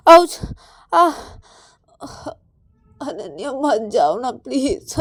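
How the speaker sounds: crying out in pain, then begging; weepy, drawn-out high plea; tearful pleading. Acted. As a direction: beg through tears not to be left. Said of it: "पायल का रोने की आवाज़".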